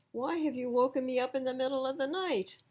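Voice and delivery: fast, high, irritated pig; grumpy pig voice. A narrator's reading. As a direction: grumble at being woken. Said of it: "grumbled Pig".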